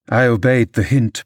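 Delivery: ordinary delivery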